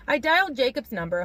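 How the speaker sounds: plain reading voice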